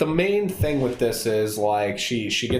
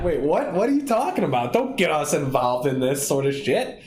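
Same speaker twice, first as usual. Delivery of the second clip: higher, indignant retold protest; incredulous; 3 semitones higher than usual. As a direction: sputter in outraged disbelief. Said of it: "smacks him".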